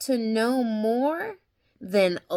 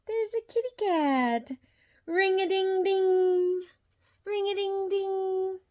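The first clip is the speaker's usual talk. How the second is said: high, bright sing-song; excited reveal; 9 semitones higher, 3 dB louder than usual. Acted. Reading the noise book to the child, high and excited. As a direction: announce it with delight.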